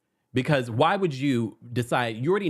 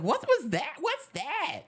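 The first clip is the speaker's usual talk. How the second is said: retold shock, pitched way up; mock alarm; slower than usual. Fan reacting to Rosie yelling backstage, mock alarm. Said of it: "we all were scared".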